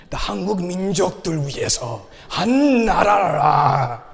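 fast Korean rising to a high loud shout; rally the nation in her voice; fervent patriotic cry. Retold or imitated. Voicing wife in Korean, crying out.

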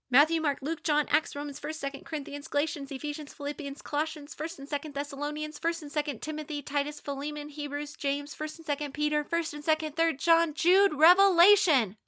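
rattled off fast in a single breath; all in one breath. Shown as a demonstration. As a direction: race through the list without breathing. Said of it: "say the books of the Bible in one breath".

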